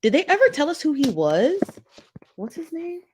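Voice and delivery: ordinary talk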